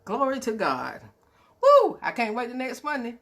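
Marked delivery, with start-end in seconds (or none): 1.6-2.0 high, loud whoop; joyful woo. Real, not acted. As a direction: let out a happy whoop. Joyful closing shout.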